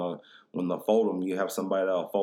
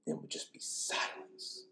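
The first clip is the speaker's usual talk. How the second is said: eerie whisper; 12 dB quieter, slower than usual. Acted. Eerie story of silent phone calls.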